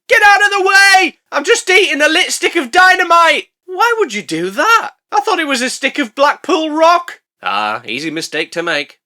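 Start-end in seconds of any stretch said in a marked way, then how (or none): 0.1-3.5 high, loud acted shout; hammed-up high-pitched holler; panicked bear shouting. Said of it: "a grizzly bear runs out of the forest shouting". 3.6-7.2 high-pitched put-on voice; hammed-up high protest; acted incredulous exchange. Acted exchange with the shouting bear.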